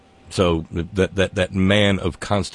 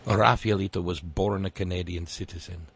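ordinary delivery

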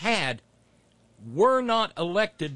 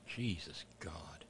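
slow, breathless whisper, much lower and softer; exasperated whisper; 10 semitones lower, 16 dB quieter, slower than usual. Real, not acted. Host reacting to the congresswoman's clip, weary whisper. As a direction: let it out under your breath in disbelief.

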